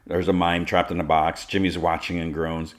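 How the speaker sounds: plain reading voice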